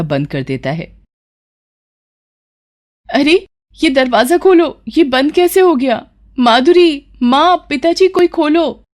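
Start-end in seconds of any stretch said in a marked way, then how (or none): 3.1-8.8 high, frantic calls for help; panicked calling. Acted. Locked-in bride calls family for help, high and panicked. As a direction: bang on the door and call for family.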